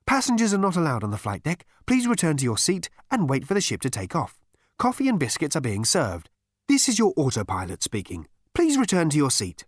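high, metallic autopilot voice; cold robotic autopilot. A narrator's reading. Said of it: "a metallic voice addressed him".

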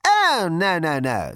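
high, loud "oh" easing down; dismissive captain. A narrator's reading. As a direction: wave the officer off from up high. Captain airily dismisses his officer.

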